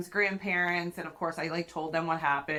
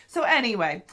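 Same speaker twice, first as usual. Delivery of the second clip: usual speaking voice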